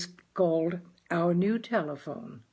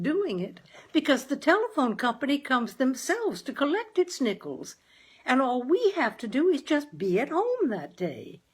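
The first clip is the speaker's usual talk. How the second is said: higher, sweet-talking wife voice; coaxing mother; 7 semitones higher than usual. Acted. Wife coaxing her husband Clinton toward a telephone, higher and sweet. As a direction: wheedle your husband gently.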